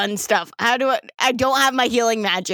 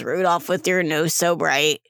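low, deadpan monotone; 5 semitones lower than usual. Acted. River teasing Rudolph about his nose, low monotone. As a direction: drop the pitch and say it with no excitement. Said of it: "like, monotonely just goes".

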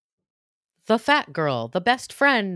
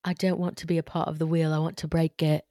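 hushed, lowered British stage accent; drama-school British accent; 4 semitones lower, 4 dB quieter than usual. Shown as a demonstration. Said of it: "That's an M F A accent".

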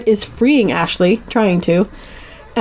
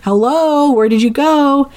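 higher-pitched searching call; 6 semitones higher, slower than usual. Retold or imitated. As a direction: call out for someone who has vanished. Character calls out for someone missing.

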